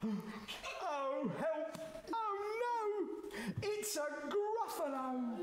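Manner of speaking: very high, squealed alarm; panicked mouse. Acted. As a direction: cry for help in a tiny high voice. Mouse meets the real Gruffalo.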